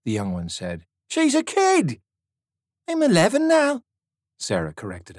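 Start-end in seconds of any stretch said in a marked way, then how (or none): 1.1-2.0 put-on young soldier protesting. A narrator's reading. Young Tommy to Riggs, high and protesting. 2.9-3.8 put-on much higher, prim girl voice; indignant girl. A narrator's reading. Girl to the soldier, high and indignant.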